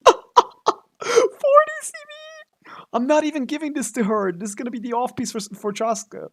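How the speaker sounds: very high, squealing astonishment; giddy disbelief. Real, not acted. Gamer amazed by a perfect roll, high giddy squeal. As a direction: squeak it out, voice shot up from shock.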